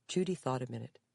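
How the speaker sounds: plain reading voice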